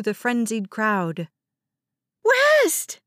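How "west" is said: shrill, loud, very high scream; terrified screech. A narrator's reading. Mother searching for her son, screeching in terror. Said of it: "Justine's voice was shrill, a terrified screech".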